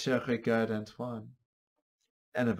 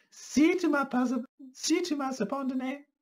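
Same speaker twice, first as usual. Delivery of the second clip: character voice. Acted. Character dialogue, high voice.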